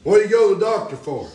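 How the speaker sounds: hollering revival preacher. Acted. Preaching loud.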